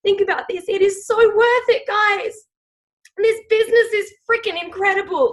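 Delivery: fighting back tears while talking; real emotion welling up; getting emotional. Real, not acted. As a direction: voice rising high and tight with emotion. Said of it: "I'm getting emotional as I think about this".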